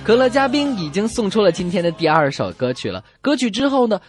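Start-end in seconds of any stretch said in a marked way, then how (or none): none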